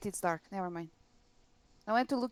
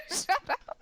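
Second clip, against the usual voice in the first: laughing protest; 6 semitones higher than usual. Real, not acted.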